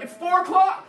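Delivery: high-pitched mock child shout; whine-shout it back like indignant children; imitating kids hollering in protest. Kids protest early bedtime.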